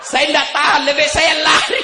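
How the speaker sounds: genie's high exasperated outburst; jin tak tahan. Acted. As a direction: cry out as the fleeing genie. Jin mengeluh tak tahan, kabur. (berseru tak tahan lagi)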